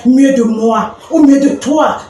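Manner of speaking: ordinary delivery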